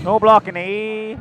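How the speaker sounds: loud Minnesota "No blocking, eh?"; Minnesota accent. Retold or imitated. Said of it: "Minnesota accent".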